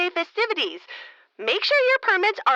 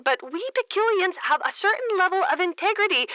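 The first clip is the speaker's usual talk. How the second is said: ordinary talk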